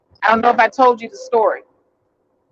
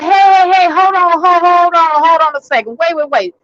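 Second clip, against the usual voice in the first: cutting in; 5 semitones higher than usual. Retold or imitated. Cutting off a yelling caller.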